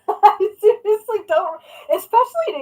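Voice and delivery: laughing through the words. Real, not acted. Vlogger laughing in disbelief at her tic. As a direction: giggle it out slowly. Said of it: "laughing disbelief".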